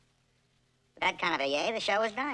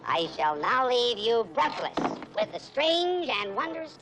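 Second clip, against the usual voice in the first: slow, high mock-grand announcing; grand storyteller; 6 semitones higher, slower than usual. [name] teasing Gladys with a grand mock announcement. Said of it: "mock grand storytelling".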